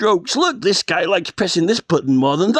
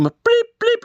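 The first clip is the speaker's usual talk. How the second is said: high squeaky blip, blip; tiny electronic blips; game blip noise; 13 semitones higher than usual. Retold or imitated. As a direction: chirp it like an old arcade game. Imitating a video game sound.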